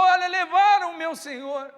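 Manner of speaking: high, loud urgent plea; desperate plea. Acted. Mary urging the gardener, loud high plea.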